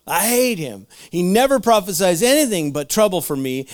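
hammed-up royal grievance; mock aggrieved king. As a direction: gripe loudly as the put-upon king. Acting out Ahab's complaint about prophet.

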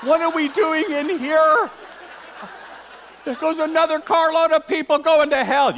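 high-pitched, mock-indignant evangelist; incredulous evangelist. Preacher imitating evangelists stuck sitting in church, incredulous. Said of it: "they're like".

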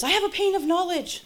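high-pitched mock announcement; imitated congregant. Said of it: "somebody stood up and says".

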